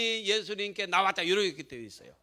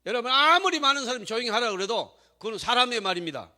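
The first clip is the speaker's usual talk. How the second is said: loud, raised-pitch sermon climax; forceful preaching; 4 semitones higher, 11 dB louder than usual. Real, not acted. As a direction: drive each phrase home to the congregation, full volume. (힘차게 외치는 설교)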